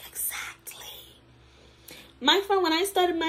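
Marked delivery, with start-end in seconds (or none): 0.1-0.9 hushed, drawn-out whisper; whispered agreement. Retold or imitated. Friend says she needs no falsies, whispered agreement. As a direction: whisper it as if point proven.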